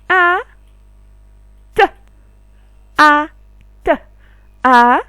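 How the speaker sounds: chaque syllabe lancée fort et détachée, pauses entre elles; syllabes articulées. Shown as a demonstration. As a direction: scander les sons comme une maîtresse au tableau. Teacher drills syllable blending. (la maîtresse fait répéter les syllabes, fort et détaché)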